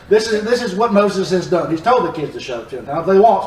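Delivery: normal talking voice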